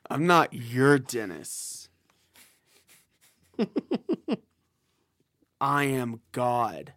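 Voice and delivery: slow, lightly voiced self-proclaimed god; hint of lofty grandeur, drawn out; grandiose Dennis. Player as Dennis telling the attendant he is God, slow and lofty.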